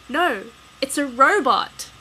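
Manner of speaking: loud, slow cry of fright; alarmed shout. Acted. Bystander yells at the giant robot in alarm. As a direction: yell it at the sight.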